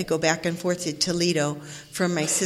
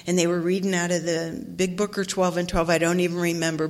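ordinary talk

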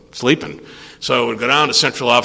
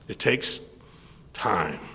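soft "it takes", pause, low "time"; hushed, spaced-out "it takes ... time"; deliberate pause; 4 semitones lower, 9 dB quieter, slower than usual. Real, not acted. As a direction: repeat it quietly, dropping "time" much lower. Repeats the point slowly, softly.

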